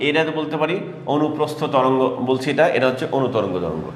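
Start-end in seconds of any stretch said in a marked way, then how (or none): none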